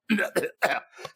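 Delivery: dusty hacking cough; allergy cough. Acted. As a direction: cough as if allergies are acting up.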